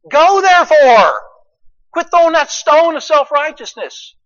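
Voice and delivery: high, loud commanding call; preaching command. Acted. Pastor voicing Jesus to churchgoers, loud commanding. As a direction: thunder the command from the pulpit.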